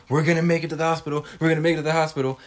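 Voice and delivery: fast, rushed mock-panicked babble. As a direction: rattle it off like a scared brother. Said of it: "Talking about some".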